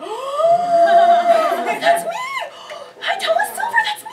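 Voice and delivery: very high, loud, laughing shout; thrilled shout. Retold or imitated. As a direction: squeal at seeing yourself in the comic.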